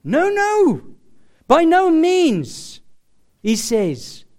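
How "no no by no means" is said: high, slow, loud insistence; preacher voicing Jesus' firm no; emphatic denial. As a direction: hammer the no's home. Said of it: "Jesus couldn't be more emphatic".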